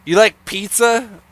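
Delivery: barging through a pickup line, loud and high; Adam. Retold or imitated. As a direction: imitate a friend's brash, jokey come-on. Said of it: "he would just barge through it".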